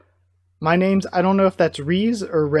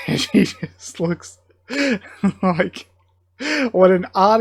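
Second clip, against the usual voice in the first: laughing; 3 semitones higher, 3 dB louder than usual. Real, not acted. Laughing while describing the scene.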